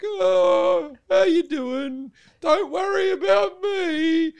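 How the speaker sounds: high, slow, loud falsely friendly drawl; sneaky snake. Retold or imitated. The snake sidling up to the frozen mouse, falsely friendly. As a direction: lure the frozen mouse in, all innocent. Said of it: "it just sort of wanders up like".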